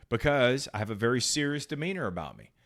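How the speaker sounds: ordinary talk, nothing marked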